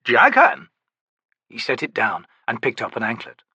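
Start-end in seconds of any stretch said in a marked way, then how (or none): 0.0-0.7 lofty, theatrical, higher; grand old Daru. Acted. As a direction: play the eccentric old trickster. Naming relics.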